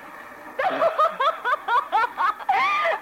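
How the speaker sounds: high, scornful burst of laughter; mocking laugh. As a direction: laugh in his face.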